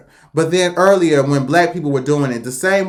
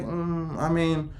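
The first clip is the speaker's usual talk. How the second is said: much softer, drawn-back murmur; mock hesitant hedge; 9 dB quieter, much slower than usual. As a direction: hedge it quietly, as if reluctant to say. Imagined reluctant reaction, hedging.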